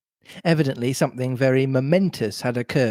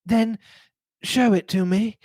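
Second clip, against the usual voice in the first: high, tightly controlled plea; desperate self-control; 8 semitones higher than usual. A narrator's reading. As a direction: press the demand, holding yourself in. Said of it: "desperately controlling herself".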